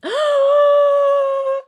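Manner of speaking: high, loud gasp of delight; excited gasp. Real, not acted. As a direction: gasp at a natural twenty. Rolls a natural twenty.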